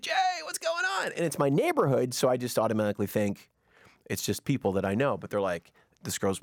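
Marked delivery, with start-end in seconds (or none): 0.0-1.1 very high imitated hail; excited greeting. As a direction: mimic people calling hello in a high voice. Imitates table of people greeting him.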